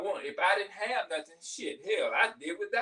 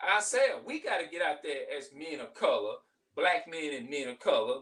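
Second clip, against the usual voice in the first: ordinary delivery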